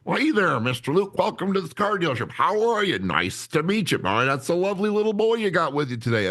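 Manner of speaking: very high, gushing car-dealer greeting; fake salesperson voice. Trainee pitching at a car dealership, fake salesperson voice. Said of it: "voice that you should put on to be a salesperson".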